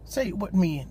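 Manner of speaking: ordinary talk, nothing marked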